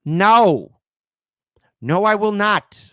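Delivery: a loud, firm no, drawn out slowly; emphatic refusal. Real, not acted. Host refusing a viewer's request, loud and firm. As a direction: slam the door on the idea, loud and slow. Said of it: "I'm not gonna say anything nice".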